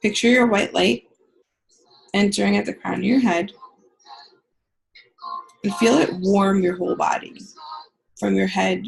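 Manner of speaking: calm guided healing meditation. Acted. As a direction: ease them into the visualization. Leads a guided visualization.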